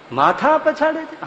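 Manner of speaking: high, slow incredulous question; disciple's stunned echo; astonished echo. Acted. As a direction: echo it in disbelief.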